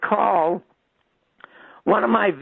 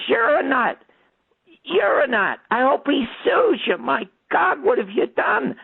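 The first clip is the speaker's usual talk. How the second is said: pitched way up in indignation; retold screaming; 9 semitones higher than usual. Storyteller berates the caller before slamming the phone, high mock scream. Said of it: "I start screaming at him".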